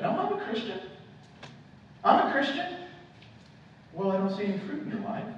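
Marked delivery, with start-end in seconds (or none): 0.0-2.7 very high-pitched protesting churchgoer; insistent churchgoer. Acted. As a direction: protest it in a much higher voice. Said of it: "There's a lot of folks in the church who say that".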